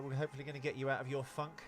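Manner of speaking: ordinary talk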